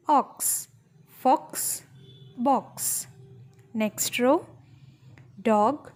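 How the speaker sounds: usual speaking voice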